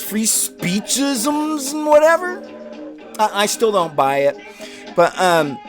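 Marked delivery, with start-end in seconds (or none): 0.0-2.4 mocking dismissal. Mocking others' free speech argument.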